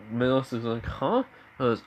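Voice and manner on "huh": high questioning "huh"; puzzled, pitch jumping up. Retold or imitated. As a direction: react with a higher, confused query. Confused listener reacts to remark.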